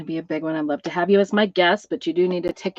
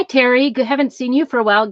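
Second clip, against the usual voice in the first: ordinary talk, nothing marked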